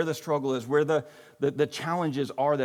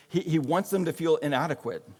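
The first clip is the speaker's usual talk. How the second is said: ordinary delivery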